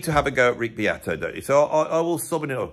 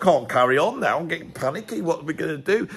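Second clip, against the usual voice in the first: higher, hammed-up panicky fret; mock panic; 6 semitones higher than usual. To the viewers, hamming up fear of AI with mock panic. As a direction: pretend to be getting panicky. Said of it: "I thought".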